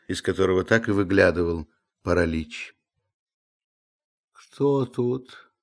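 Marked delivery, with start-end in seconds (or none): 4.3-5.5 hoarse, sleepy question; lightly husky, higher waking voice. A narrator's reading. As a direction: croak it like someone just woken. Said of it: "спросил он хриплым голосом".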